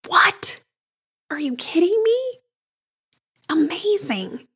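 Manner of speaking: high, breathy disbelief; real amazement at the sister; amazed. Real, not acted. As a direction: gasp it out in delighted shock. Amazed by sister's kind reply.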